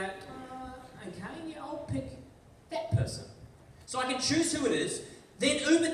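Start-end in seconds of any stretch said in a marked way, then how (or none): 0.0-3.3 higher, softer mock musing over the app; choosing a driver. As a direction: quietly pick a driver, half to yourself. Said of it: "and you go".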